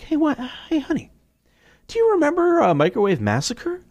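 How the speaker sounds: high, sing-song teasing to his wife; mock innocent high voice; teasing himself. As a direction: needle her with a silly high voice.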